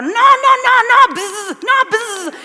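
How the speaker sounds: loud, high comic refusals with buzzes; mock outraged protest. Horrified relatives reject the food processor, imitated loud high protest.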